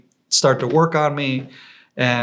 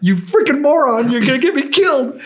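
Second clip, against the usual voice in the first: high, loud furious rant; angry yell; 13 semitones higher, 6 dB louder than usual. Retold or imitated. Sick guard yells at his own team, high, loud and furious. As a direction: tear into them at full volume.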